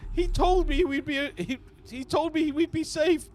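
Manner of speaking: frightened human prisoner pleading; begging prisoner. Acted. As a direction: beg for his life in a squeaky voice. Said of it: "He's like".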